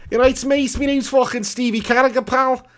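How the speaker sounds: high-pitched, hammed-up Scouse; Scouse accent. Streamer playing a Scouser moaning about the game, in high-pitched Scouse. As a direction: put on a thick Scouse caricature, pitched well up. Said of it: "Pig, do a Scouser impression".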